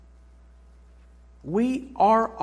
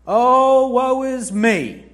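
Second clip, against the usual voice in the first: hammed-up "oh woe" wail, louder and drawn out; mock a self-pitying sufferer; theatrical sigh of self-pity, slow; mock lament; 6 semitones higher, 10 dB louder, much slower than usual.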